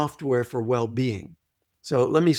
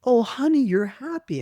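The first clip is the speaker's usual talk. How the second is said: put-on high, cooing mother talking to her baby; loving mother voice; 8 semitones higher than usual. Mother cooing to her happy baby, high and tender. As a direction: coo to the infant like a doting mom.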